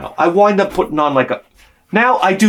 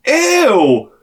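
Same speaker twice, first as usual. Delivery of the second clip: disgusted niece; 9 semitones higher, 4 dB louder than usual. Retold or imitated. Hip niece reacts to costume.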